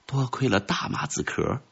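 hushed, relieved aside; very small voice. A narrator's reading. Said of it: "用很小的声音说". (小声庆幸地说)

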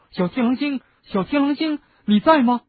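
high, loud anxious call. A narrator's reading. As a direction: call into the empty house. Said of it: "他喊道".

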